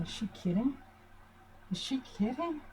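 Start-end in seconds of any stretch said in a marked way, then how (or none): 1.7-2.6 higher, softer disbelief; a light stunned aside; incredulous. Retold or imitated. Mother replaying her disbelief at daughter, higher and softer. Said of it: "this is what I was thinking".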